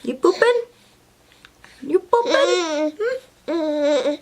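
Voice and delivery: high, playful cooing questions. Real, not acted. Teasing her baby mid-story.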